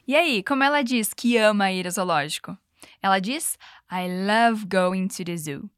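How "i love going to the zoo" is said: slow model line. Shown as a demonstration. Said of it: "First, repeat".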